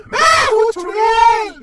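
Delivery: slow menacing witch shout; mother pretends to be a witch, loud and slow. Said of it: "अब acting मत कर".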